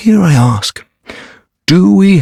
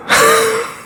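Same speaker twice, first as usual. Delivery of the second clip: short, high huff of laughter; bitter laugh. Acted. Deserter learning the lords' fate, bitter laugh. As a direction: let out one bitter laugh.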